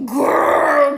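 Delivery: loud, drawn-out exclamation; scandalized phone-gossip holler; gossiping outrage. Retold or imitated. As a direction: stretch it out loud to hook the friend. Mother on phone to friend, outraged.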